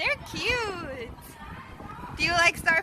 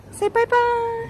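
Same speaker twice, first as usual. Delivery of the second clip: high coaxing voice; 7 semitones higher, 4 dB louder than usual. Real, not acted. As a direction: lift it high and sweet. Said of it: "Midi, look at me".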